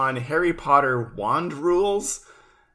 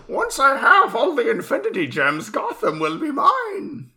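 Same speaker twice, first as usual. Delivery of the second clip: supervillain voice; 13 semitones higher, 6 dB louder than usual. Pretend. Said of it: "mock supervillain declaration".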